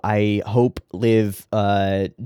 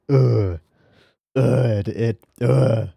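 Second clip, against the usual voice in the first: disgusted groans; 3 dB louder, much slower than usual. Real, not acted. Disgust at cruel kids.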